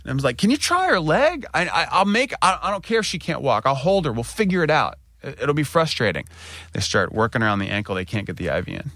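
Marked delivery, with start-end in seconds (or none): 0.4-6.3 high, rushed pleading; retold dad pleading with the nurses; urgent pleading. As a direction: push them hard to try again. Pleading with nurses for his kid.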